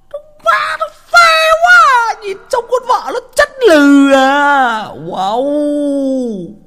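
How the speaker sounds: very high, loud, stretched-out wail of awe; mock awed cry. Telling his mates about the wife, crying out in mock awe. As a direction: howl it like you can't believe your eyes.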